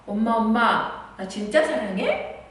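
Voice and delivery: put-on slow, drawn-out child's plea; child asking. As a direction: plead slowly like a little girl. Child asks mother again. (천천히 조르는 아이 목소리)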